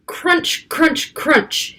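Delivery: crunchy sound words. Acted. Storyteller voicing footsteps in snow, playful. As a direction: make the snow crunch under his boots.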